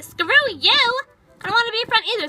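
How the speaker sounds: angry shout at the driver. Retold or imitated. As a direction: yell back at him.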